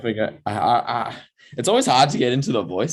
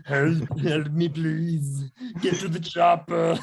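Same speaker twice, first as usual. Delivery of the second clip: Arnold impression; 7 semitones higher, much slower than usual. Shown as a demonstration. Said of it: "is that Arnold".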